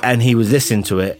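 normal talking voice

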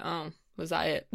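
a small, underwhelmed murmur; softly deflated; mild disappointment. Retold or imitated. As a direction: quietly let down, recalling a reaction. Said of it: "a little bit disappointed".

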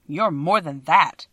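slow, emphatic reproach; grieved tone. A narrator's reading. Scarecrow reproaching the little man, in a grieved tone. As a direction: draw it out with a grieved weight. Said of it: "said the Scarecrow in a grieved tone".

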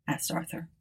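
ordinary delivery

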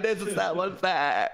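high, slow wail with laughter; mock crying. Guest retelling Christmas isolation to co-host, mock crying. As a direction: whine it tearfully, half laughing.

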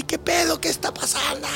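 startled. Retold or imitated. Man startled by smoke columns.

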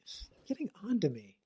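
soft unvoiced whisper. Real, not acted. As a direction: whisper it like a sulky aside. Whispered complaint to co-host.

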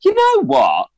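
very high-pitched exclamation; retold sudden resolve. Retelling when he decided to be weird, high exclamation. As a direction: pitch it way up, as if declaring a decision. Acting out his moment of decision.

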